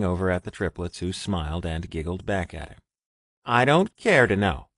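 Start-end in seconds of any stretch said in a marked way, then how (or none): 3.4-4.7 pouting. Acted. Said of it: "Richard pouted".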